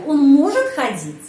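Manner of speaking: может said loud, pitch peak on it; asking with stress on может. Shown as a demonstration. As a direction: hit может as the question word. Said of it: "Saya bertanya".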